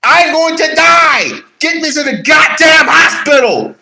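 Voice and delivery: a dying man yelling for the hospital; panicked shout. Acted. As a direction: scream it like you're dying.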